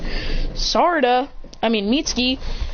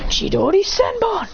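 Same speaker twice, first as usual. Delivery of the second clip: high, slower move name; attack call; 9 semitones higher than usual. Acted. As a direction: announce the strike in a raised pitch. Character calls out an attack.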